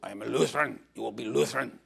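a hint of a stern, commanding father; lightly voiced order from the Hitler-like father; stern stepfather. Stepfather orders the boy into church, lightly stern. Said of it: "he said".

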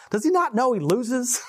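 high, amused disbelief; can't believe it, ask high; high mock-baffled question; incredulous question. Real, not acted.

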